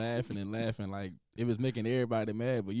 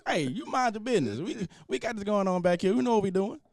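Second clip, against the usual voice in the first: mock telling off; 8 semitones higher, 3 dB louder than usual.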